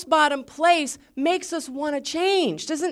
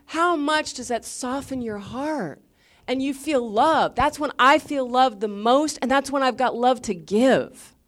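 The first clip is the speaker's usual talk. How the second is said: ordinary talk, nothing marked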